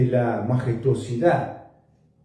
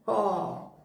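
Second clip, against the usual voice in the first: ordinary talk, nothing marked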